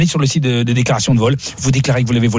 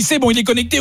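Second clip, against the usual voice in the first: lightly voiced salesman, higher and breezy; mock salesman voice; 9 semitones higher than usual. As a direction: play the cheerful clerk, pitched up. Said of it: "Il dit".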